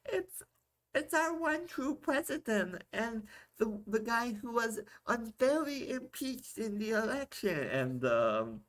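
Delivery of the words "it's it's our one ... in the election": put-on high, slow, sweet little-girl voice; mock tiny child reciting proudly. Three-year-old girl at the door answers her dad, high and sweet. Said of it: "And she's like".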